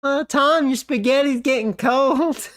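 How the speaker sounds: mom calling. Retold or imitated. Mom voice retold again.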